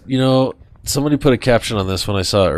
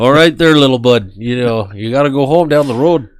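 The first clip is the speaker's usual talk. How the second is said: put-on friendly Canadian accent, pitched up; 2 semitones higher, 4 dB louder than usual. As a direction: send little bud home in a Canadian accent. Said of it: "I do a Canadian accent".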